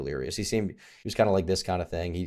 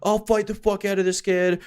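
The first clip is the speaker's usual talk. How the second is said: much higher, drawn-out strained threat; mock raging drunk; 11 semitones higher than usual. Guy dragged away from the bar, hammed-up threatening. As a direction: imitate a hothead being held back.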